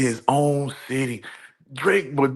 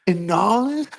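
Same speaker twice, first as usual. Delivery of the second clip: incredulous rising question; 8 semitones higher, 4 dB louder than usual. Real, not acted. Disbelief at Super Bowl booking.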